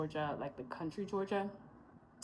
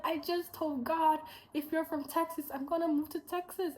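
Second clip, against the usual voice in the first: much higher, bursting with excitement; excited gush; 9 semitones higher than usual. Retold or imitated. Telling the Texan girl she is the sign, excited gush. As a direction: blurt it out twice, overjoyed.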